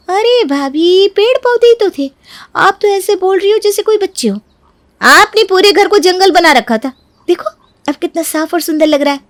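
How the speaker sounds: high, airy brushing-off; dismissive younger sister-in-law. Acted. Voices dismissive Neeru. (बेपरवाह देवरानी की ऊँची आवाज़)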